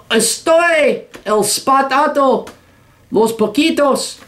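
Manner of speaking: mock Spanish accent, high and slow. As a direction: drawl fake Spanish in a high mocking Spanish accent.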